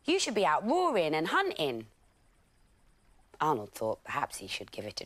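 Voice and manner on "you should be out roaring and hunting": lions urging. Acted.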